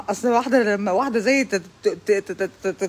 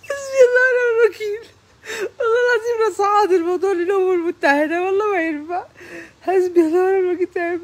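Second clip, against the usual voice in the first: mock crying; 8 semitones higher than usual. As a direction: sob it out in comic despair. Comic fake crying lament.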